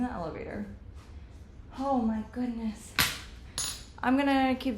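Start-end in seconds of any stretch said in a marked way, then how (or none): 1.8-2.9 breathy sigh after stairs; winded, catching her breath. Real, not acted. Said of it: "I'm so out of breath".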